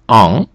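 "ан" as a French nasal a, n not sounded; French nasal vowel. Shown as a demonstration. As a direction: model "ан" for the letters en. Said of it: "читається як".

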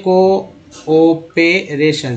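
"Cooperation" drawn out very slowly in separate parts; syllable by syllable. Shown as a demonstration. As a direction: sound out "cooperation" piece by piece for learners. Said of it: "सीओ को को बोलते हैं". ("Cooperation" एक-एक हिस्सा तोड़कर, बहुत धीरे)

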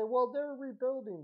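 lightly mocking imitation of a fan; mock fan objection. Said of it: "And you'll say".

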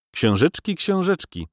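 wry, bitter jeer; bitter smile. A narrator's reading. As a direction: toss it back with a bitter smile. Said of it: "uśmiechnął się gorzko".

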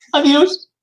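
high, rising sing-song farewell; teasing high adiós; mocking goodbye. Real, not acted. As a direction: taunt the fallen Pokémon.

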